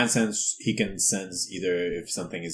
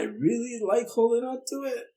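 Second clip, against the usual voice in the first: possessive whine. Acted. Player character clings to fruit.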